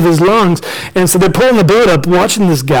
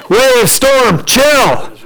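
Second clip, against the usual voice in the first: raised, higher-pitched command; commanding Jesus; 5 semitones higher, much slower than usual. Acted. Jesus on the boat commanding the storm, authoritative. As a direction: order the storm to settle. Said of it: "He stands up on the boat and he says".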